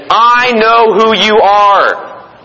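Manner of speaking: slow, drawn-out demon voice; demon's outcry. Acted. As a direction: stretch each word out slowly, calling out as the demon. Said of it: "And the demon says".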